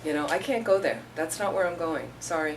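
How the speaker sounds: ordinary delivery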